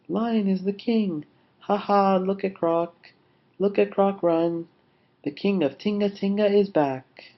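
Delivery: high-pitched, excited crowd calls; jubilant cheering. Acted. Animals celebrate Crocodile running away, cheering.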